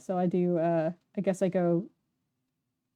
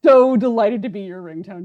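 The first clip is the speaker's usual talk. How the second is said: loud, thrilled outburst; delighted; 3 semitones higher, 12 dB louder, much slower than usual. Real, not acted. Streamer asked to be a viewer's ringtone, loudly delighted. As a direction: belt out your delight at the compliment.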